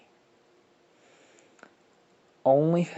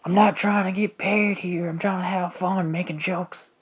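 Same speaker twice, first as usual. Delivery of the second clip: goofy high voice; 7 semitones higher, 4 dB louder than usual. Real, not acted. Goofy voice explaining to viewers.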